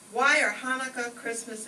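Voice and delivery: ordinary talk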